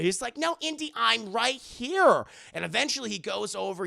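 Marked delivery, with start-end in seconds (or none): 0.4-2.3 higher, slow, louder pleading; slow insistent call. Acted. Willie insisting Indy come to her, slow and louder. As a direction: stretch each word to pull him back.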